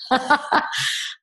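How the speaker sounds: short, light chuckle at herself; a soft little laugh after the line. Real, not acted. Amused at her own herb oil story, light laugh.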